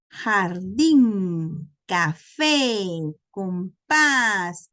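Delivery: jardín, café, compás, each final syllable loud and stretched; stress on last syllable. Shown as a demonstration. Teacher giving examples of agudas, stressing the last syllable of jardín, café, compás. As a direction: punch the endings: jar-DÍN, ca-FÉ, com-PÁS. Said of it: "lleva la fuerza de voz en la última".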